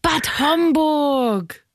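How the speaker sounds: a hammed-up big reaction to tease the caller; mock strong reaction. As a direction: stretch it out as if the town were thrilling. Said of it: "eine starke Reaktion bringen".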